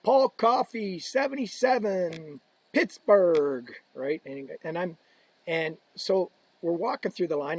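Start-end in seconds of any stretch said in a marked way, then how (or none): 0.0-3.7 imitated rink announcer, higher and drawn out; arena announcer. Introducing the next player on ice, announcer voice. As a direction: call out player, number, city with long vowels. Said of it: "would go out and go".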